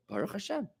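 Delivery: soft, peaceful Baruch Hashem; quiet accepting Baruch Hashem. Shown as a demonstration. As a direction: let "Baruch Hashem" drop gently, no fuss. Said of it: "Say Baruch Hashem".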